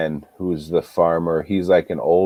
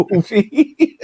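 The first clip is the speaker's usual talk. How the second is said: ordinary delivery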